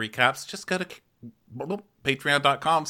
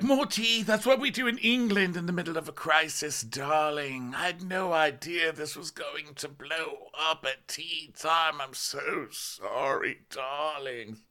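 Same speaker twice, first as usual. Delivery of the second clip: mock Vanderpump British; 6 semitones higher than usual. Imitating Vanderpump.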